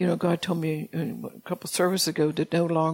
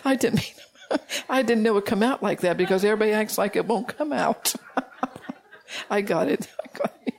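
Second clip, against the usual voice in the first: real giggles through the words; laughing at her own oil spill; 4 semitones higher than usual. Real, not acted.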